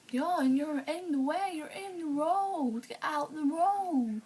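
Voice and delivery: put-on insistent child ordering someone off the road; bossy little cousin. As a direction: drag out each word like a stubborn kid. Little cousin repeats his order.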